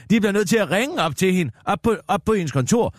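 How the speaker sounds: excited emphasis. Shown as a demonstration. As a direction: push it up as the juicy bit. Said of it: "Ja, men hør nu lige".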